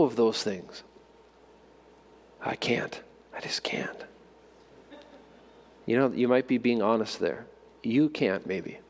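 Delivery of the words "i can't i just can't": breathy whisper, almost no voice; soft voiceless despair; whispered helplessness. Pretend. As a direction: whisper it like someone who gives up. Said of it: "imagined listener refusing".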